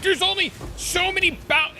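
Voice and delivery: very high squealed whine; mock exasperation. Opponent protests the endless bouncing, mock exasperation. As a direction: imitate an opponent losing it mid-complaint.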